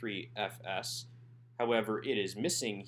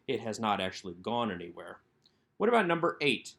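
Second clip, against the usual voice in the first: ordinary talk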